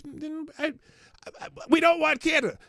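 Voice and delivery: high, loud burst on don't; emphatic outburst. Real, not acted. Insisting the US doesn't want Canada.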